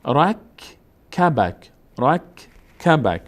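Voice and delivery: بک رک spaced out slowly; slow syllable demo. Shown as a demonstration. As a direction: split بک and رک apart, slowly. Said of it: "ب میچسبه به کاف". («بک، رک» آهسته و جدا جدا)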